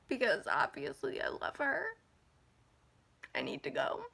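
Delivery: very soft, voice giving way; close to tears. Real, not acted. Admitting she loves her grandma, near tears. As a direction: barely get it out before crying. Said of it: "I'm, like, about to cry".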